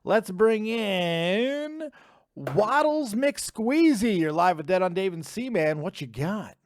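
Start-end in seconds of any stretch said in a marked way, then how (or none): 0.0-4.2 long drawn-out, raised announcer call; announcer intro. Real, not acted. Host bringing in the next caller, drawn-out announcer style. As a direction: stretch the words out like a ring announcer.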